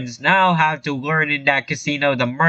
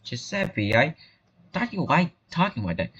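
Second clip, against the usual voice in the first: normal talking voice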